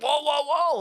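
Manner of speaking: high, drawn-out whoas; alarmed intervening. Retold or imitated. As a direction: jump in to halt a fight, retold. Retold friend breaking up fight.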